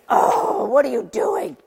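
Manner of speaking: an exasperated high "Oh" sliding into a weary question; exasperation. Retold or imitated. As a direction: sigh it out at the dog. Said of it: "it was exasperation".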